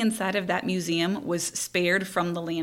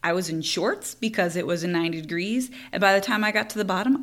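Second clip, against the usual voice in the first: ordinary talk, nothing marked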